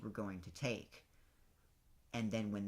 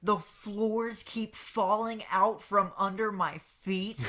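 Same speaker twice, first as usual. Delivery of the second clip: high whiny Bowser; 8 semitones higher, 7 dB louder than usual. Retold or imitated. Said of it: "This badass monologue".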